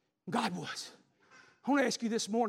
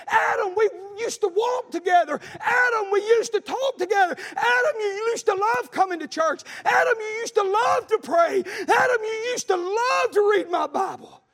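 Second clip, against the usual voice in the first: loud pleading shout, higher pitched; God calling Adam; 10 semitones higher, 8 dB louder than usual. Acted. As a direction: plead with a lost child at full voice. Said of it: "He's saying".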